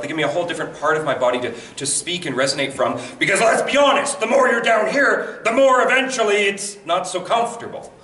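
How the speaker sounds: ordinary talk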